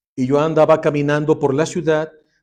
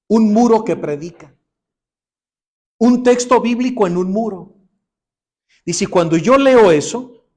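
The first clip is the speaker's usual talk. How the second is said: louder and higher, building passion; fervent preaching; 6 semitones higher, 6 dB louder than usual. Acted. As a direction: proclaim the miracle to the congregation. Preaching testimony rising to climax. (predicación fervorosa, más fuerte y aguda)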